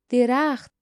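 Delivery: loud burst of discovery; sudden exclamation. A narrator's reading. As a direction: call it out as a sudden find. Sudden discovery of the sought shelter. (با هیجان و صدای بلند)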